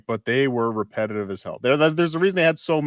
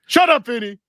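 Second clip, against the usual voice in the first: mock snap; 12 semitones higher, 6 dB louder than usual. Mock-snapping at chatter.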